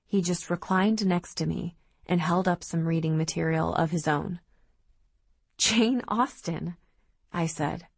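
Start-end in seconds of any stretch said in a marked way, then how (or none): 5.6-6.8 slow, high-pitched disbelief; drawn-out incredulous query; disbelieving. Acted. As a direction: draw out the name, unable to believe it.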